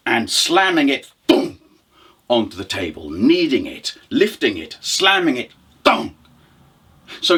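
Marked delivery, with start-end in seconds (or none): 1.3-1.6 resonant "boom" of dough landing; sudden punchy thud noise; imitated thud. Storyteller showing dough slammed on the table, imitated thud. 5.8-6.2 short ringing "boom" of slammed dough; imitated thud. Storyteller showing dough hitting the table, imitated thud.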